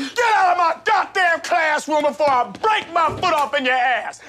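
high furious shout; furious yelling. Acted. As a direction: scream him out the door. Teacher throws student out.

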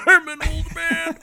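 high, loud cartoon old man; grumpy old man. Shown as a demonstration. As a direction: crank up a wheezy geezer voice. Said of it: "what I meant to do was more like this".

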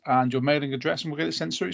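ordinary talk

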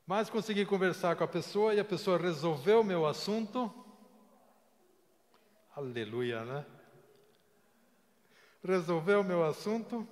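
loud and high, rejoicing; triumphant. Real, not acted. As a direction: proclaim the victory joyfully. Triumphant sermon climax.

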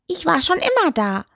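condescending. A narrator's reading. Toe tells the witch it was always there, condescending. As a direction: say it as if she were stupid. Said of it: "in einem Ton, als ob Miep Miep blöd wäre".

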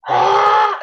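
loud high scream of panic; loud high panicked scream; retold scream. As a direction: yell out as the hornets sting. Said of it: "running around the house screaming".

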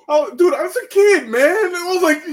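high, loud wail of protest; retold dismay. Retells distraught reaction to the scene.

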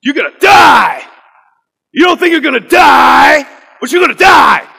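imitated high, booming pulpit shout; Pastor Kevin Cosby. Pastor starting a sermon to graduates, shouted preacher imitation. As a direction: thunder it with long held final words. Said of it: "good way to start off a sermon".

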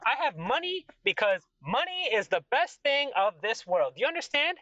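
high, emphatic royal rant; boastful flustered king. Acted. King boasting of his baths and money, flustered.

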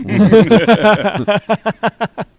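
loud high burst of laughter; big laugh. Real, not acted. Old hunter laughing at his own armadillo story, loud high burst of laughter. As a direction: crack up hard at your own punchline. Punchline of the coon dog story.

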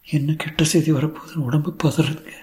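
high-pitched, fretful dread; anxious wife. Acted. Tearful wife dreads bad news. (பதறும் மனைவி, உயர்ந்த குரல்)

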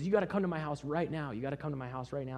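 high-pitched, excited urging; insist, rushing the repeat; urgent insistence. Retold or imitated. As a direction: imitate someone frantic to bring you along. Revived man urges him home.

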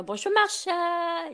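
high, slow French greeting in a put-on voice; old French man. Imitating the old French yoga classmate greeting her, sing-song. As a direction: croon the hello like the sweet old Frenchman. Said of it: "he would always say".

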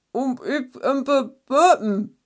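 stammering, muffled sounds; muffled by a scarf. A narrator's reading. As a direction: mumble through a scarf over the mouth. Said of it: "den Schal wegnehmen, damit man sie verstand".

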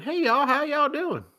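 high, loud Southern greeting; hammed-up Southern twang; Southern accent. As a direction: holler it like a Southern kid. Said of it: "mocking Southern accent".

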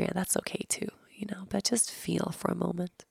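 breathy meditation whisper. Acted. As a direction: whisper it gently to the listener.

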